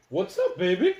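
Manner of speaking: high, loud doggy greeting; talking to dog. Real, not acted. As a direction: call sweetly to the dog. Calling to his dog.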